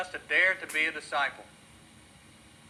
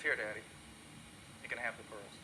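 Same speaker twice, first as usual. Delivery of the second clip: low, soft, giving in through tears; tearful little girl; 5 semitones lower, 11 dB quieter than usual. Acted. Girl giving her father the pearls, tearful and soft. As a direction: hand over the pearls, near crying.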